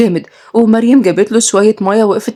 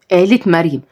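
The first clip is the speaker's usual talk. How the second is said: usual speaking voice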